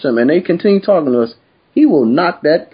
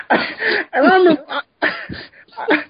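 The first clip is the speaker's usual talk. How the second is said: laughing through it; 7 semitones higher, slower than usual. Real, not acted. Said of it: "laughing retelling bathroom".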